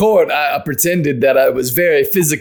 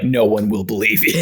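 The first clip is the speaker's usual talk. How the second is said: O J Simpson impression; 8 semitones lower, 3 dB quieter than usual. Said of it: "He would just be like".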